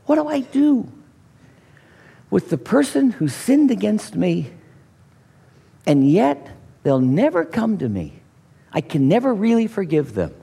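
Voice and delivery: ordinary talk, nothing marked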